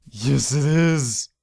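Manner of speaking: slow, drawn-out happy exclaim; delighted. Real, not acted. Finding the ocelot, slow delighted exclaim. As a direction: savour the discovery.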